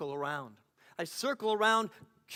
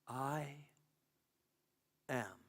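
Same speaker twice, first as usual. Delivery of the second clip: very soft and low, with a long pause between the words; barely audible, low and spaced out; faint voice; 4 semitones lower, 9 dB quieter than usual. A narrator's reading. Faint voice from the hush speaking to a straining listener, very soft. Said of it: "a voice so faint".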